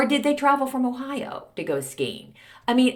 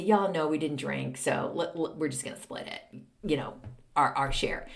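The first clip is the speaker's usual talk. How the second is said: quiet firm explanation; 6 semitones lower, 8 dB quieter than usual. Retold or imitated. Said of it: "I would say".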